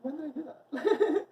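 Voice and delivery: high, slow mock innocent question; mock own voice. Answering her mom in a high, slow mock voice. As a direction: stretch it out high and puzzled. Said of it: "And I'm like".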